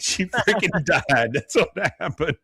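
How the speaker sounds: high, laughing exasperation. Real, not acted. Host mocking Peggy's question, laughing through it. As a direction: crack up while making the point.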